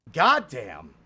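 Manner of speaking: goddamn said loud, rising then falling; a loud, amazed goddamn; real thrill on goddamn; excited goddamn. Real, not acted. After clutching a boss kill.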